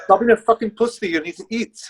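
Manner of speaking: put-on Russian accent. Russian coach scolding his athlete to eat, imitated accent. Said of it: "in, like, a Russian accent".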